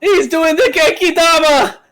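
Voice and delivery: high, loud hyped battle shout; anime battle cry. Pretend. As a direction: scream the attack like an anime hero.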